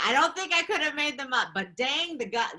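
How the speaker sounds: high, fast and loud; excited. Real, not acted. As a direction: burst out with delight. Excited about her kids.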